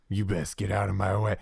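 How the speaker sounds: lightly voiced surly bounty hunter; a hint of bounty hunter menace. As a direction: warn them off as a tough-guy NPC. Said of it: "you meet this bounty hunter dude".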